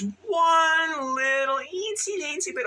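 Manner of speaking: very high, drawn-out little squeak. Real, not acted. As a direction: stretch it out high, as if tiny. Exaggerating a tiny flaw.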